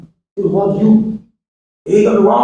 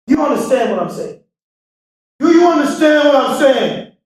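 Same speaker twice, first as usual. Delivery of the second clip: emphatic shout; 8 semitones higher than usual. Acted. Speaker to crowd, demanding they understand, shouting. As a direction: push it out to the back row. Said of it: "repeated question rising".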